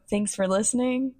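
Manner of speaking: ordinary talk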